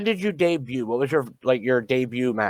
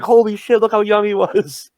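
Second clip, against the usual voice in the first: high, louder mock-astonished outburst; retold amazement; 7 semitones higher, 3 dB louder than usual. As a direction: re-enact being amazed by an old photo. Retells amazed reaction to old flyer.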